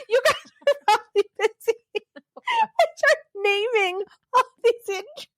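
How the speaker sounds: high, words squeezed through giggles; helpless laughter. Real, not acted. She confesses her awkward chat with the officer, laughing. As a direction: crack up while telling it. Embarrassing story, cracking up.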